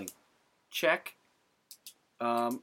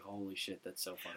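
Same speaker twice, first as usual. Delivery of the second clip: hushed thought; 5 semitones lower, 14 dB quieter than usual. Retold or imitated. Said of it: "I was like".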